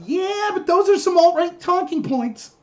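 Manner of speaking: high, slow, drawn-out mimicry; mock objection. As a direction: imitate a dismissive critic, stretched out and high. Mimics a dismissive commenter.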